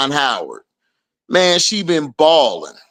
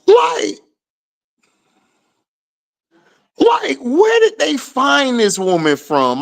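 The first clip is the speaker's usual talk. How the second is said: much higher disbelieving rant; incredulous; 9 semitones higher than usual. Real, not acted. Streamer ranting about Nola in high disbelief. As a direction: ask it in utter disbelief.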